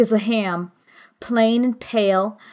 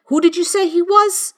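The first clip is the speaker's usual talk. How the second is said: high, insistent question; sharp questioning; 8 semitones higher, 4 dB louder than usual. A narrator's reading. Silver questioning the boy about the runaway, sharp and high. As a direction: demand the name, voice pitched up.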